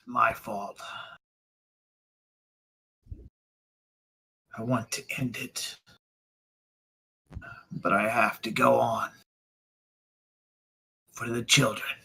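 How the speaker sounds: quiet confession with long pauses; subdued, broken resignation; weary despair. Acted. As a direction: admit guilt with all the fight gone. Guilty magistrate, despairing.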